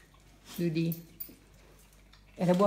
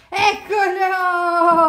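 excited; 9 semitones higher, 9 dB louder, much slower than usual. Real, not acted. Finding hidden child.

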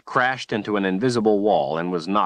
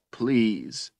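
tearful please; 3 semitones higher than usual. A narrator's reading. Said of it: "Richard cried".

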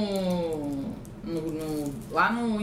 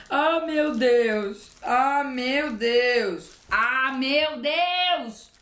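very slow, stretched ah meu Deus; drawn-out delighted disbelief; overwhelmed awe; 3 semitones higher, much slower than usual. Real, not acted. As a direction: savor each word in disbelief. Overwhelmed by the gift.

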